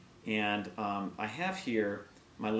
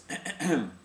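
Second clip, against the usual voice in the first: a quick demonstrated throat clear; throat clear demo; 4 semitones higher than usual. Shown as a demonstration. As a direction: clear the throat once to show it. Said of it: "Never clear your voice".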